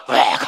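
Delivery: loud, sudden mock vomit noise; acted throwing-up sound; imitated retching. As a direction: burst out with a retch. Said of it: "하고 제가 토하고 있더라는".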